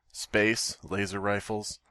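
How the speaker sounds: usual speaking voice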